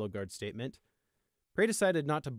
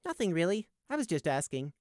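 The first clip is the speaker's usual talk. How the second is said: light, high brush-off; airy, innocent denial; feigned disinterest; 7 semitones higher than usual. A narrator's reading. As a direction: back off casually, pitch easing down. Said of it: "like he hadn't been that interested anyway".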